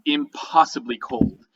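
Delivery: ordinary talk, nothing marked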